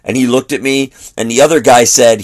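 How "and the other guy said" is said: ordinary talk, nothing marked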